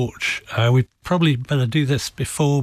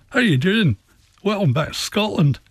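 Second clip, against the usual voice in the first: higher-pitched Scottish lilt; Scottish accent; 8 semitones higher than usual. Retold or imitated. Border Scot welcoming a visitor back, imitated Scottish accent. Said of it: "talk with a very different accent".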